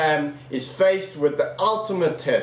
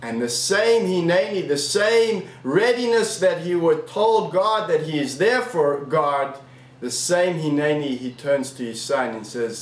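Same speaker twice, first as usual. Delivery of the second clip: normal talking voice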